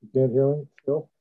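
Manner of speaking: muffled, like through water; underwater. Real, not acted. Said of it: "It's, it's quite muffled".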